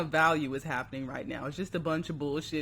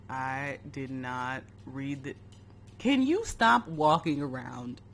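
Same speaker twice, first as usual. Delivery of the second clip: ordinary delivery, nothing marked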